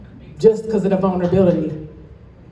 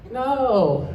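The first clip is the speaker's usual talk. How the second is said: normal talking voice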